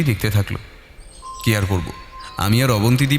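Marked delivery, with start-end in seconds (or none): none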